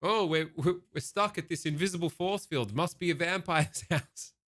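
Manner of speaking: mock baffled intruders. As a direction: act out being stuck at an invisible wall. Voices people stuck outside vampire's house.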